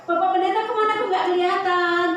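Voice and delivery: put-on high, loud child's question; curious child. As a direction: kid calling out for the pastor. Child looking for pastor.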